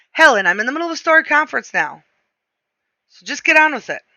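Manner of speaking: high-pitched, testy brush-off; harried husband cutting the call short; impatient. Acted. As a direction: hurry the caller along, exasperated. Impatient husband lying to his wife.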